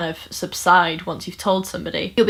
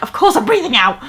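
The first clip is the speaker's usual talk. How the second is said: mock indignant outburst, louder; indignant retort; 5 semitones higher, 6 dB louder than usual. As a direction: snap back like a teen feeling patronised. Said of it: "I felt like she was being patronising".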